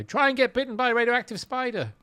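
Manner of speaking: high-pitched jokey call after someone; mock shout. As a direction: yell advice after a kid, playfully. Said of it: "I'd have shouted after that kid".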